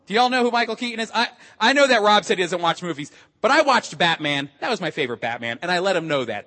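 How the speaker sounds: ordinary delivery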